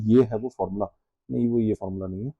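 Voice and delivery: low, hushed aside; muttering to self. Real, not acted. Teacher looking for the right formula on screen, muttering. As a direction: drop the voice as if checking for yourself.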